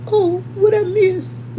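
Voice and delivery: high, slow mock-innocent question; imitating Lucretia. Teacher asks the staring room what she missed, high and slow. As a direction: ask it sweetly, as if nothing happened. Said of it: "she was like".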